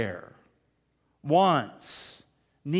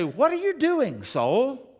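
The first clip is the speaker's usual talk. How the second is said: challenging own soul; 9 semitones higher, 4 dB louder, slower than usual. Acted. Preacher scolds own soul.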